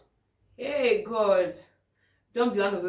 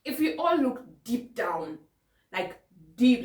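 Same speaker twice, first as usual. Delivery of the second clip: usual speaking voice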